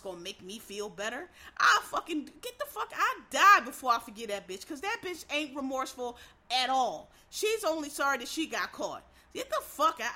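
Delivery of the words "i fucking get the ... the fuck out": louder, higher, furious yelling; real anger boiling over at a remorseless killer; furious outburst. Real, not acted. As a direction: blow up at the idea of forgiving. Furious rant at killer's apology.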